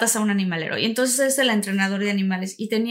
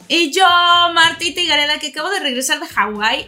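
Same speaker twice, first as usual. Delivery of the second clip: higher, louder cheerful self-introduction; excited intro; 6 semitones higher, 7 dB louder than usual. Real, not acted. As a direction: announce yourself with energy. Co-host introduces herself. (presentación animada)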